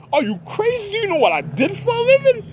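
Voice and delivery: outraged shouting at the intruder; indignant yell. Retold or imitated. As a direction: fire off the outrage high and quick. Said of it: "I was like".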